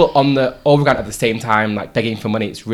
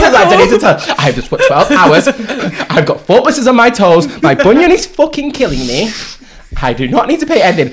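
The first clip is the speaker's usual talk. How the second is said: raised, higher voice listing complaints; exasperated rant; 6 semitones higher, 5 dB louder than usual. Retold or imitated. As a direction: vent every grievance at once. Retold rant at stranger.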